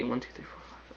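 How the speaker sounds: counting under the breath in a whisper. Real, not acted. Counting to himself in a bare whisper.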